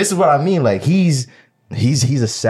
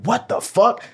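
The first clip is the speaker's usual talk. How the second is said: shocked; 10 semitones higher, slower than usual. Retold or imitated. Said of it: "So I was like".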